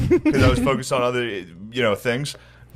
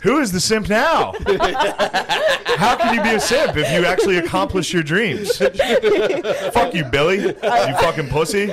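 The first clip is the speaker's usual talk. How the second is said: laughing gloat; 8 semitones higher than usual. Real, not acted. Triumphant gloating with the leash.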